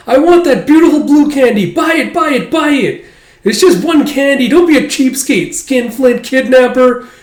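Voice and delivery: put-on very high, loud bratty kid; whining child demanding candy. Son nagging his father to buy candy, high whiny child. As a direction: whine and nag like a spoiled boy.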